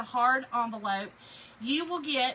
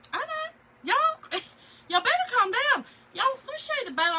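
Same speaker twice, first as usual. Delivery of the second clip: high, loud playful squeal to chat; gushing back at the viewers' hype; giddy high-pitched teasing; excited; 12 semitones higher, 6 dB louder than usual. Real, not acted. Excited reply to chat comments.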